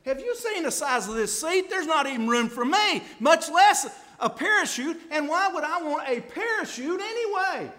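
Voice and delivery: high, loud scoffing passenger voice; mock the cramped seat with a squawk; indignant passenger. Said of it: "and he's gonna say".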